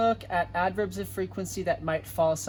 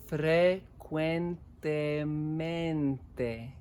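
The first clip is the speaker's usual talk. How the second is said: Frecuentemente drawn out syllable by syllable; very slow; much slower than usual. Shown as a demonstration. Teacher to class, very slow model word. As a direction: stretch Frecuentemente slowly for the class.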